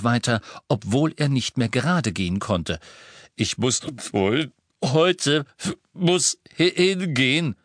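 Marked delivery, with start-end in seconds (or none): 3.4-7.5 slow, strained, high and gagging; sick and retching. A narrator's reading. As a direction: force it out while nauseous. Sick wizard insisting he must go.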